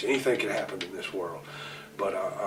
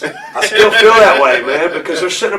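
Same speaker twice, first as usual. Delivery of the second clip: laughing; 9 semitones higher, 17 dB louder than usual. Real, not acted. Laughing while still standing by it.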